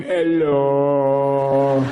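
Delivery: low, loud lion's hello; a sated lion greeting the crowd; deep booming hello. Acted. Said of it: "big fat lion going".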